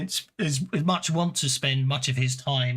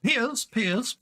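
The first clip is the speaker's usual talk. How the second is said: calling a servant, pitched up; 8 semitones higher than usual. Acted. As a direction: call out across the house. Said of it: "he calls out".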